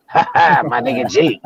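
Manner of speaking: lightly imitated movie-star swagger; Denzel in Training Day. Fan imitating the star's reaction in the crowd, mock gloating. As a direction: laugh out a cocky, half-done film impression. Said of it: "his motherfucking Training Day voice like".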